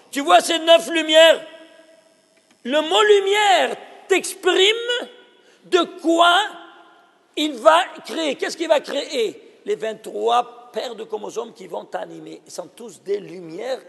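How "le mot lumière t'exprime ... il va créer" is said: higher, slow, loud exclamation; wonder-struck slow shout; excited exclamation. Real, not acted. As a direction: announce it like a marvel. Teacher exclaiming about light.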